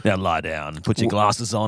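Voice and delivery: low radio host. Pretend. Continuing old man radio bit.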